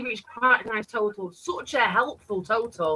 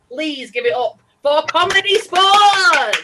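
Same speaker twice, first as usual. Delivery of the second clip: loud introduction; 5 semitones higher, 7 dB louder, slower than usual. Real, not acted. Host introducing the act.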